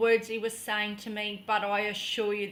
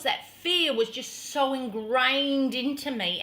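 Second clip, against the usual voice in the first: ordinary talk, nothing marked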